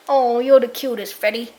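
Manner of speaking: ordinary talk, nothing marked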